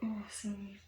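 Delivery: hushed, breathy aside; quick whispered sorry; real whisper. Real, not acted. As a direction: drop to a whisper to apologise. Aside while recording, apologising.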